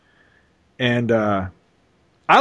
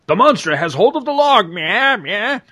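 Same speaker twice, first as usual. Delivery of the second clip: high-pitched mock alarm voice; put-on higher voice with silly chatter after; hammed-up comic character; 6 semitones higher than usual. Reading the guy's line as Kong grabs the log, hammed-up high voice. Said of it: "the guy goes".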